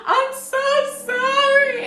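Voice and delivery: high, wailing mock cry; mock sobbing apology. Girl bursting into tears apologizing, high mock wail. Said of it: "She said".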